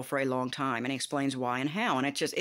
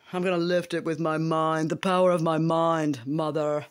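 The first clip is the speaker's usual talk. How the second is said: mock grand declaration; 4 dB louder than usual. Mock-grand boast to mother.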